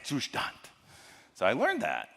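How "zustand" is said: Zustand said "zoo-shtahnt" with sh and a hard t; German correction. Retold or imitated. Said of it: "the German folks came out".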